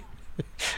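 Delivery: quiet airy chuckle; a small sheepish laugh; soft breathy laugh. Real, not acted. Father laughing after apologizing to his sister, soft chuckle.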